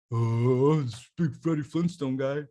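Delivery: deep put-on oafish big-guy voice; deepened voice. Shown as a demonstration. Storyteller describes the bouncer, deepened voice. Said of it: "do you deepen your voice? Do you make it lower?".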